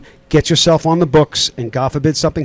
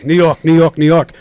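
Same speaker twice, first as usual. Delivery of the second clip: a hammed-up New York accent, a bit louder; imitating strangers who instantly recognise a New York accent; mock New York accent; 7 dB louder than usual. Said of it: "they know right away".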